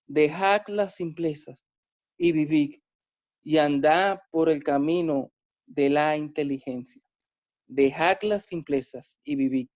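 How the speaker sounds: measured, solemn Bible reading; calm, deliberate scripture reading. Acted. Reads the Proverbs verse twice.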